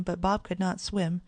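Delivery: ordinary delivery, nothing marked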